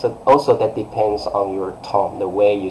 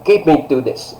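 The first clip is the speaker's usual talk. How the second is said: higher, forceful command; strong, serious order; 4 semitones higher than usual. Shown as a demonstration. Teacher demonstrating a command to students, strong and serious. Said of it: "very strong and serious".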